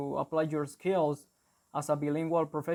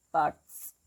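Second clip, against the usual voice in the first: BUCKS fronted to 'packs'; New York accent; 4 dB louder than usual. Shown as a demonstration. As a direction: model New York 'bucks' with a short 'a'. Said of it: "in New York, because they say".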